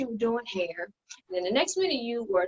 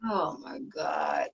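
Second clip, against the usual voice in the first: slow, drawn-out, lower and softer; exasperated groan; 5 semitones lower, 6 dB quieter, much slower than usual. Acted. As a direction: let it sag out of you, fed up. Sister exasperated by the argument.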